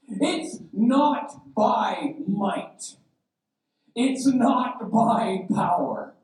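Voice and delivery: high, drawn-out proclamation; preaching proclamation. Real, not acted. Preacher quoting scripture to the church, proclaiming slowly. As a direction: stretch each word out, pitched high.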